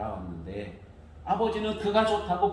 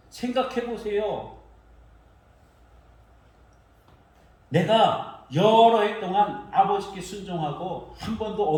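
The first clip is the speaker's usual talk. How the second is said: hurt protest; 4 semitones higher, 6 dB louder than usual. Retold or imitated. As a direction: let the resentment pour out. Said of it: "그러면서 서운해하고 있는 것입니다".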